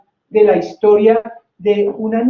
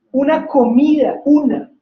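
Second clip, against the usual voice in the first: una comida with una hit hard and high; stress on una; 5 semitones higher, 3 dB louder than usual. Shown as a demonstration. Teaching a class, stressing only one meal. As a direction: punch the word una. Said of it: "no decía dos".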